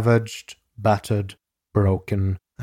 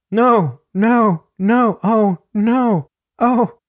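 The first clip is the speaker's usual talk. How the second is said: high, loud separate cries of protest; acted screaming; 12 semitones higher, 6 dB louder, slower than usual. A narrator's reading. Narrator reads the victim's screaming, acted high cries. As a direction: cry out each word high and loud.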